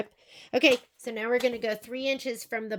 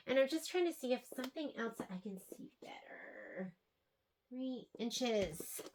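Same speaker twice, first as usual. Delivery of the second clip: breathy whisper under her breath, unvoiced; whispering to herself; 14 dB quieter than usual. Real, not acted. Quilter whispers to herself while checking the line, hushed. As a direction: murmur it privately while squinting at the fabric.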